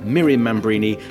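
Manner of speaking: ordinary talk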